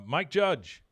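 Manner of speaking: loud mock call to a celebrity. Real, not acted. Podcast host calling out to Mike Judge, loud plea. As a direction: shout his name like a plea.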